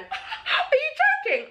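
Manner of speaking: very high, drawn-out squeal through laughter; shocked disbelief. Retold or imitated. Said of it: "I literally went".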